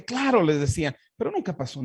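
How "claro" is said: imitating a high, people-pleasing yes; obliging agreement. Young man humouring church leaders, obligingly agreeable. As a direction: raise the pitch, just going along. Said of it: "por condescendiente les decía".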